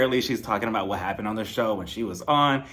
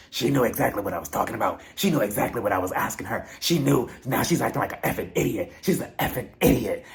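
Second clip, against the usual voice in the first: mock-indignant Denise voice, slightly lowered; imitated dragging; 4 semitones lower than usual. Denise dragging Erica to the others, mock indignant. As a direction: imitate her running someone down. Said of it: "takes this opportunity to drag Erica".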